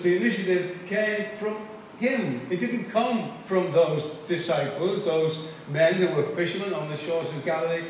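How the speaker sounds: normal talking voice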